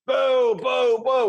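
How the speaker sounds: loud mock booing from the outfield; crowd booing. Bugs heckling the Gorillas from the outfield, loud booing. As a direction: boo him like a heckler in the stands. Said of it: "you hear in the outfield".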